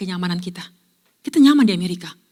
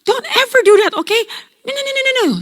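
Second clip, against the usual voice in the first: much higher, louder tearful pleading; retold sobbing plea; 14 semitones higher, 6 dB louder than usual. Teenage girl begs the pilot through tears, frantic.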